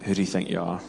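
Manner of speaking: low, hushed voice of rebuke; soft, deep imagined divine reproof; Holy Spirit. Retold or imitated. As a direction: drop right down and quietly confront. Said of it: "I just felt the Holy Spirit almost".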